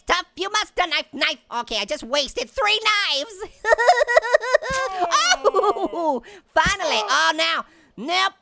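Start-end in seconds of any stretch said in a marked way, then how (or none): 3.4-5.4 loud high burst of laughter; loud gleeful cackle; big laugh. Real, not acted. Laughing at wasted knives.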